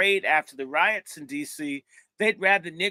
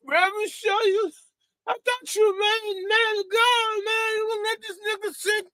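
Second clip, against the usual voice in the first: pleading thief. Retold or imitated. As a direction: beg the preacher to save you. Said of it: "and he says".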